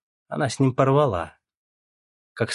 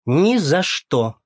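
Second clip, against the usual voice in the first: shouting; 7 semitones higher, 6 dB louder, much slower than usual. A narrator's reading. Оля refuses, shouting.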